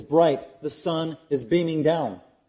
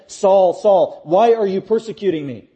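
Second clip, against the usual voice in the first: loud, emphatic call from heaven, higher-pitched; emfatyczny głos z nieba; 4 semitones higher, 7 dB louder than usual. Acted. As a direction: call out the name forcefully, then press the question. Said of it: "it's emphatic".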